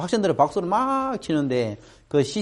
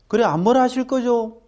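coaxing teacher; 10 semitones higher than usual. Retold or imitated. Teacher coaxing, voiced quote.

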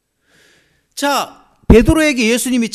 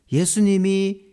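normal talking voice

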